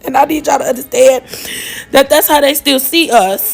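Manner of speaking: real laugh cracking into a cry. Real, not acted. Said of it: "laughing sometimes is my trauma response".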